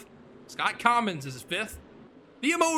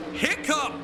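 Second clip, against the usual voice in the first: excited; 6 semitones higher than usual. Real, not acted. Car charging through the field.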